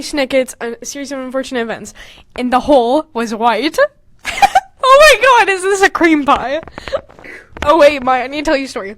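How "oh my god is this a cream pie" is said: normal talking voice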